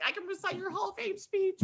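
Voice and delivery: very high, small fan squeal; starstruck gushing. Retold or imitated. Fan blurting to her idol, high starstruck squeal.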